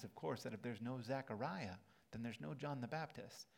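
ordinary delivery

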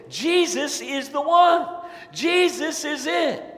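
high, loud, slow, awed proclamation; joyful announcement. Retold or imitated. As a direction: proclaim it with delight. Disciples excitedly announce Jesus.

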